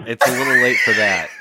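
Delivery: shouted comeback; loud retort. Real, not acted. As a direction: fire the retort back loud. Retort to the caller.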